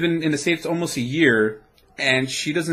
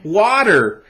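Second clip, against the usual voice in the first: echoing American; 6 semitones higher, 5 dB louder than usual. Shown as a demonstration. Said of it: "make you say".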